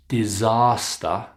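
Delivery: "Disaster" said dizahster, slowly; long ah. Shown as a demonstration. Teacher models word for practice, long ah in "Disaster". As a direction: stretch the middle of "Disaster" to ah. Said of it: "It's the long ah sound".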